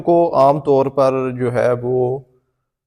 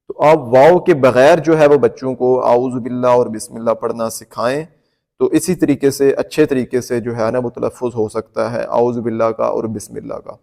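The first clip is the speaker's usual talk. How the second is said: ordinary talk, nothing marked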